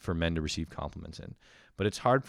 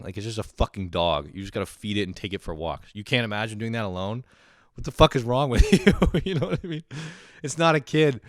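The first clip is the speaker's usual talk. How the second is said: ordinary talk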